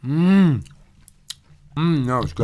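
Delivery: talking through a hot mouthful; mouth burning from spicy food. Real, not acted. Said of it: "Oh my God, it's hot".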